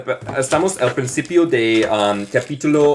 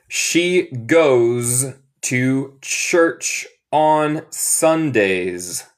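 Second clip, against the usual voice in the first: very slow, word by word; slow teaching model; much slower than usual. Shown as a demonstration. Teacher reads the sentence for learners, very slow model. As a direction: stretch every word, with gaps between. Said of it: "Repiten después de mí".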